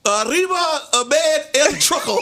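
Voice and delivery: high, hammed-up bad Spanish; mock Spanish. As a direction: imitate clueless fake Spanish, pitched high. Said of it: "so he was like".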